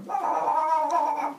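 high, loud goofy babbling; monster babble. Retold or imitated. Hundred-handed giants babbling as they throw rocks, high and loud. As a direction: gabble like happy giant monsters.

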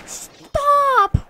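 loud shriek of stop, voice cracking high; high crying shout. Real, not acted. Gamer under fire shrieking at players in distress. Getting shot in game.

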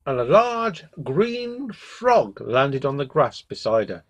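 plain reading voice

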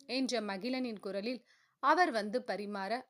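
ordinary delivery, nothing marked

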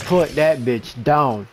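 loud command. Real, not acted. Urging team kill.

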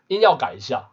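usual speaking voice

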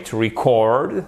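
"record" said re-CORD, end stressed; weak "re", long stressed CORD in "record"; stress on second syllable. Shown as a demonstration. Teacher correcting to the verb form, second-syllable stress on "record". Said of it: "l'accent tonique est sur la seconde syllabe".

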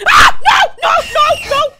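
very high, loud burst of cries; panicked shrieking. Real, not acted. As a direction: shriek in rapid terror. Caught in the game.

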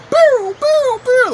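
loud, high "boo" noises for flying shots; blasting sound effect. Retold or imitated. Recounting soccer shots blasted over kids' heads, loud high sound effect. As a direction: fire off high-pitched booming shot noises.

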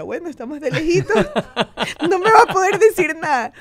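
high and loud, laughing through the words; giggle, safe far away from dad; laughing relief. Retold or imitated. Teen daughter giggling that her distant father can't scold her. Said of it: "Entonces yo decía".

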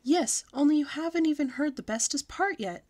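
very loud. A narrator's reading. Girl talks loudly over clapping classmates. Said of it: "I said very loud".

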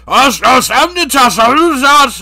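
high, jumbled mock foreign babble; garbled foreign killer. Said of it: "Chikatilo's like".

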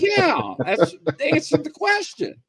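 high, quick and self-satisfied; smug retort. Retold or imitated. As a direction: fire back high as if it is obvious. Retorting to baffled tech staff.

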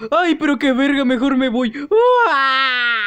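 high, loud startled yelp; scared flight. Acted. Player spots a creature and flees screaming. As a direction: shriek and bolt away. Character sees monster, flees.

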